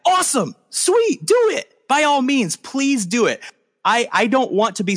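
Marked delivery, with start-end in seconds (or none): 0.1-1.7 high, bright-eyed pretend fan; squeaky mock hype; mock excited cheer. Mock enthusiastic listener quote. 1.9-3.4 excited listener. Pretend. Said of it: "I want you to listen to my podcast and say".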